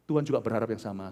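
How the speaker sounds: ordinary talk, nothing marked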